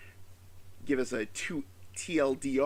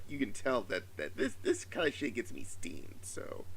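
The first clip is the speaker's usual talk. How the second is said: normal talking voice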